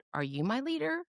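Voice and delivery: high, rising wondering thought; wondering question. Retold or imitated.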